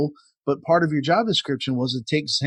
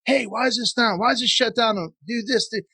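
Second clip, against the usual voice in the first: mild mock yell, higher pitched; pitched-up scolding, only half yelled; retold yelling; 8 semitones higher than usual. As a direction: imitate a boss coming out to yell at you. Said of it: "they would come out and yell at you".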